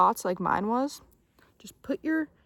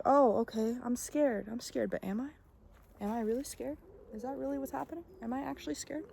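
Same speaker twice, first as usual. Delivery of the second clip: much softer, hushed self-questioning; questioning the fear; 3 semitones higher, 7 dB quieter than usual. Retold or imitated. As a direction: gently question yourself, almost under your breath. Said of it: "And then you're like".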